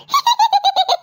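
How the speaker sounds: bursting high giggle; high laugh. Acted. A friend laughs at the fridge joke, high and bursting.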